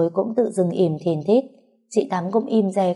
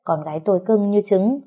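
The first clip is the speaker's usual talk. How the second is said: ordinary delivery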